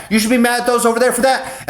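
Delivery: the goading voices at their most insistent; mocking nagging voice at its peak. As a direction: egg him on at the top of the build. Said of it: "everybody's like".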